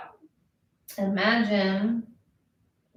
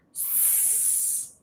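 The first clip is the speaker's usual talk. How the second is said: soft unvoiced hiss; snake hiss; 19 dB quieter than usual. Shown as a demonstration. Teacher shows the class the slithering snake, very soft. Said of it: "slithering snakes".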